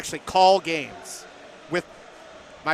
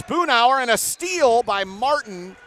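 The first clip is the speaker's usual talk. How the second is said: excited play-by-play; 6 semitones higher, 5 dB louder, slower than usual. Real, not acted. Commentator calls a steal by Martin live, excited play-by-play. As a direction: voice jumps higher and louder on the steal.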